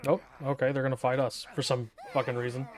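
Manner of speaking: normal talking voice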